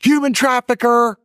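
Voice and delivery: drawn-out, high and loud accusing call; mocking accusation. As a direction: shout the label like a slow jeer. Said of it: "she filmed me on TikTok and said".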